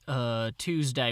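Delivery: normal talking voice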